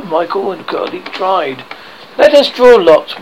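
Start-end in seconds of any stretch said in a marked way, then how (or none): 2.2-3.2 bold and louder, higher; brave. A narrator's reading. Wendy offering to draw lots with Peter, brave. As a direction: put on a brave face. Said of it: "Wendy said bravely".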